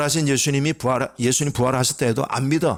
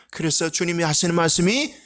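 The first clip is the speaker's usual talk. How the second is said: usual speaking voice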